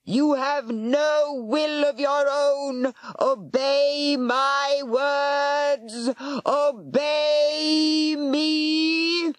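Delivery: high, very slow, eerie commanding voice; hypnotic warlock command. Acted. Warlock commanding the serpent, hypnotic and slow. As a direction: bend the creature's will slowly.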